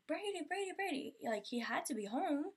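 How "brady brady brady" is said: high frantic calls of the name; screaming name. Retold or imitated. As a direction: scream for the dog in panic. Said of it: "I'm screaming".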